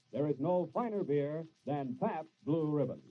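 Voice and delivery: normal talking voice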